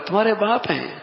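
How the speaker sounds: high-pitched astonishment; surprise. Shown as a demonstration. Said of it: "حیرت کا اظہار کر رہا ہوں".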